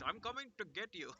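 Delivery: put-on menacing Middle Eastern accent threat. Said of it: "like, a Middle Eastern, like, voice".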